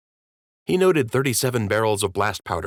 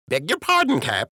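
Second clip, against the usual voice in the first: put-on much higher, chirpy old dockhand; high-voiced dockhand greeting his captain; Jimmy the docker's voice; 9 semitones higher than usual. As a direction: pitch it way up like a simple dock worker.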